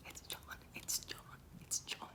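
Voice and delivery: hushed unvoiced warning; urgent whisper. Warning his father that John is at the door, urgent whisper. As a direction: whisper so the guest won't hear. Warning dad the visitor came.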